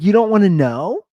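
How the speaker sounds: imitating a loud, high, astonished busybody; incredulous question. Nosy neighbor to the man who won't ask, incredulous.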